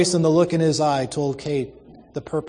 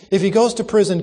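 higher, louder urgent plea; pleading; 6 semitones higher, 5 dB louder, faster than usual. A narrator's reading. Kate begs Missus Jeffs for his liberty, pleading. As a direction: press the case for the old man. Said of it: "Kate was pleading with Miss Jeffs".

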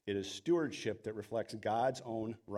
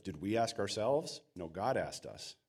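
usual speaking voice

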